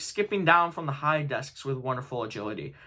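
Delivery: ordinary delivery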